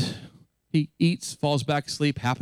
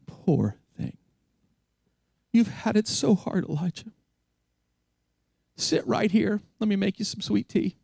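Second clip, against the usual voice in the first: mock coddling sympathy; 4 semitones higher than usual. Mock sympathetic God, then "No.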